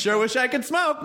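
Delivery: high, mock ad voice; commercial voice. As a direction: pitch it like a bad ad. Mock ad line.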